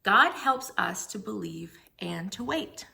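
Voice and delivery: usual speaking voice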